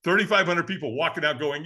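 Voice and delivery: usual speaking voice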